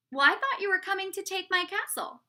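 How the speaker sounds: high-pitched self-justifying protest; defensive king. A narrator's reading. King defends himself to dragon.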